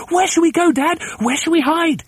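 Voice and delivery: put-on high-pitched child's cry; excited little ones. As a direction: pipe up eagerly like a small child. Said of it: "cried the two little ones".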